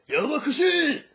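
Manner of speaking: loud, high mock shout; Jotaro yelling. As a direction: bark it out like an annoyed Jotaro. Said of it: "you see Jotaro at that point".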